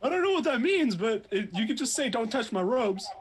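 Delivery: loud high mocking whine; mock him in a high whine. Player mocks in high voice.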